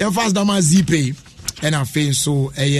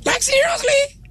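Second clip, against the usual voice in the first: incredulous. Real, not acted. Host amazed by guest's singing.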